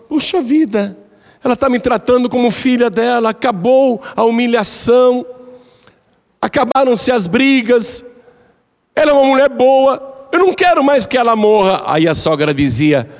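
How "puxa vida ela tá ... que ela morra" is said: remorseful daughter-in-law. Retold or imitated. Daughter-in-law repents aloud.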